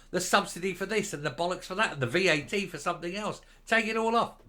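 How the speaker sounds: ordinary talk, nothing marked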